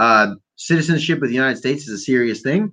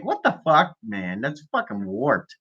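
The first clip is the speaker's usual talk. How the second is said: ordinary talk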